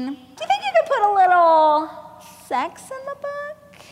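rising high, then hushed on sex in the book; coy suggestion. Retold or imitated. Agent delicately asking the author to add sex, dropping to a hush.

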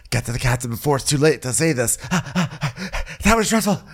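panting, gasping between hurried phrases; acted breathlessness. Gigi climbing up to tell the captain, out of breath. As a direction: puff it out as if just climbed up. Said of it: "climbed up to be like".

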